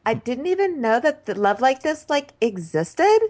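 "existed" stretched out and rising like a question; upspeak. Retold or imitated. Imitating her love speech, upspeak lifting on "existed". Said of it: "everything's in upspeak".

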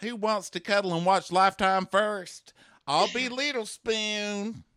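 high, loud, over-eager put-on voice; hammed-up excited girlfriend impression; [name]. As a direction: call it out high and drag the ending. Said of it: "[name], whoa, calm down over there".

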